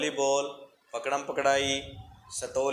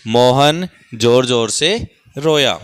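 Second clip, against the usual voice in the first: loud, slow model reading for the class; 3 semitones lower, 12 dB louder, slower than usual. Shown as a demonstration. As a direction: announce the sentence slowly to everyone. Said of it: "तीसरी line आ गई बेटा". (ज़ोर से धीरे पढ़कर सुनाना)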